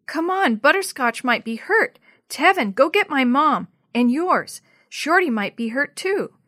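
rally the others in alarm; urgent alarm. A narrator's reading. Jenny sends friends for help, urgently.

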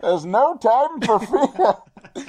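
put-on high, loud, drawn-out villain in wobbly British accent; slow hammed-up masked villain impression; Bane. Movie villain addressing his foe, bad Bane impression. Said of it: "Why is Bane Australian?".

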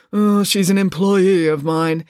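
slow, evasive hedge; father dodging the journalist, slow and evasive; hedging. Acted. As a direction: stall while dodging the truth. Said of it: "hedged Mister Green".

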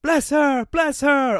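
very high, loud ceremonial chant; high ringing acclamation; intoned crowd response. A narrator's reading. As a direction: intone it loudly as a congregation. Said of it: "all the people intoned".

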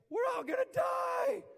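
mock-panic scream of doomed disciples; panicked yell. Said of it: "And they're like".

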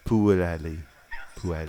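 Puelli run smooth with no throat sound; missing the CH. Shown as a demonstration. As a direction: say Puelli as "poo-ELLY", no guttural. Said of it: "Really gotta get the ch".

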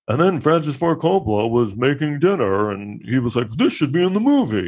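high, hammed-up storytelling voice; mock biopic line. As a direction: parody a cheesy biopic anecdote. Mocking biopic's trivia dialogue.

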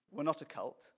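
ordinary delivery

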